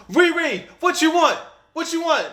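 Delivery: loud, high-pitched mom calling out; mother calling kids. Retold or imitated. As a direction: imitate a mother hollering for her kid's order. Mother calling kids in retold story.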